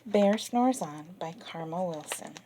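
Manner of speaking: plain reading voice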